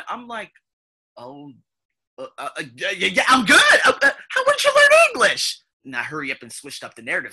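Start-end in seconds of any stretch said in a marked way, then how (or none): 1.2-5.6 stumble, then blurt it out high in mock shock; flustered astonishment. Customer stunned by salesgirl's English, flustered stammer.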